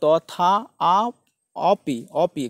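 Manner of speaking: তথাপি pulled apart as তথা, আ, অপি, with pauses; broken into parts. Shown as a demonstration. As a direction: sound out তথা, then আ, then অপি separately. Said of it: "আমার সাথে উচ্চারণ করলে".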